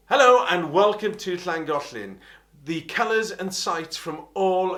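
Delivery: bright broadcast piece to camera; presenter voice. Shown as a demonstration. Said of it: "doing a piece to camera".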